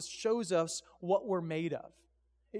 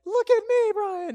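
very high, loud cartoon squeak; Mickey Mouse; 10 dB louder, slower than usual. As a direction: imitate a show-off friend in a loud mouse squeak. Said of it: "apparently he speaks like Mickey Mouse".

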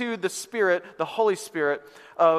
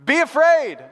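loud, high mock warning; exclaimed, hammed-up alarm; alarmist news message; 8 semitones higher, 7 dB louder than usual. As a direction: shout it like a scary headline. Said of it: "a little more like this".

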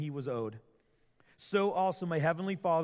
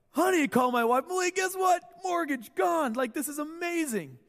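higher and louder, bursting with excitement; overjoyed; 11 semitones higher, 5 dB louder than usual. Pretend. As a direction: shout the good news to the house. Imagined joy at cancelled mortgage.

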